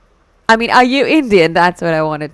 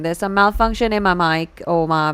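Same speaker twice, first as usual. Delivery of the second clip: usual speaking voice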